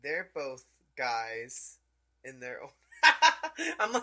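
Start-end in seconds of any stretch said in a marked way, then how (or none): 2.9-3.7 loud squealing laugh; high burst of laughter. Real, not acted. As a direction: crack up suddenly. Laughing at theory.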